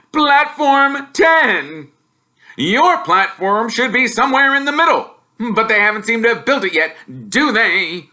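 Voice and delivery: very high, loud, slow gloating; nasty grinning Uncle Vernon; taunting uncle. Acted. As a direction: gloat at the stranded boy.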